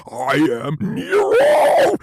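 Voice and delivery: very high, slow, screaming demon. Retold or imitated. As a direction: shriek it like a possessed demon. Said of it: "talking like the demons in".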